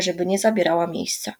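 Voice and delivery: ordinary delivery, nothing marked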